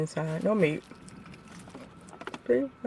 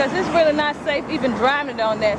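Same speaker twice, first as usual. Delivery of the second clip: upset; 7 semitones higher, 4 dB louder than usual. Real, not acted. Upset customer explaining unsafe car.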